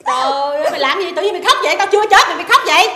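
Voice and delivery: high, loud startled protest; alarmed scolding. Acted. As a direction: demand to know why she is bawling. Startled by sudden crying.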